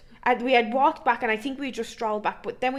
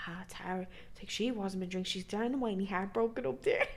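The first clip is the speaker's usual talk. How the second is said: hushed uncle; 3 semitones lower, 10 dB quieter than usual. Retold or imitated. Uncle reassuring sister quietly.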